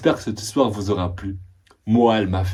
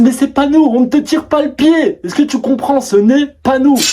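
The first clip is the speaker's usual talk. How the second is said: much higher, louder panicked denial; high-pitched frightened protest; 13 semitones higher, 6 dB louder than usual. Retold or imitated. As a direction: insist it isn't them, scared. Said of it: "Elles me disent".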